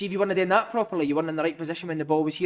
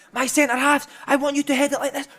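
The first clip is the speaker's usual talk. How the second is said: high, animated coach voice; manager coaching; 7 semitones higher than usual. Retold or imitated. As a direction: bark the drill at defenders. Manager demonstrating headers.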